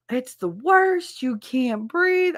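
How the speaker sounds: high, drawn-out whining exaggeration; mock overdramatic suffering, slow and high; mock melodramatic complaint. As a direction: stretch out the agony, mimicking dramatic complainers. Mimicking people complaining about vinegar shots.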